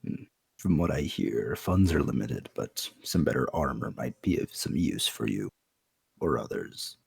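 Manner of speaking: low, soft character voice; quiet, deep-voiced counsel; measured in-game advisor. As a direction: advise discreetly, pitched well down.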